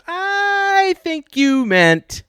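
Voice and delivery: very high, drawn-out smarmy correction; condescending sing-song know-it-all. Acted. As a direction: stretch it high, then settle into the correction. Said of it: "Google got all smarmy with me".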